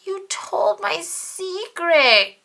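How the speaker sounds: high, loud hurt cry; cried out. A narrator's reading. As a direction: cry out betrayed. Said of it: "cried Bert".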